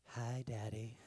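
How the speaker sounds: put-on low, eerie child voice; a hint of a spooky daughter greeting; creepy little girl. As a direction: sound like a horror movie kid. Daughter creeping up like horror movie.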